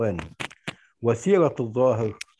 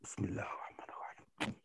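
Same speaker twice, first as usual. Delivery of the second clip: whispered; 4 semitones lower, 20 dB quieter, faster than usual. Acted. Storyteller opens the tale.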